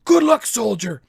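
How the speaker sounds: high-pitched, very loud send-off; sergeant major yell. Acted. Sergeant major dismisses departing soldier, loud yell. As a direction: bellow the farewell like an order. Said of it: "yell at the knuckleheads".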